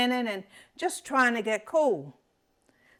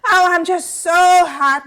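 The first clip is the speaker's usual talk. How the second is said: hammed-up complaint; 8 semitones higher, 7 dB louder than usual. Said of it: "lays it on and fanning".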